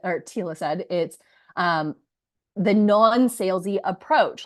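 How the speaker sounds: ordinary delivery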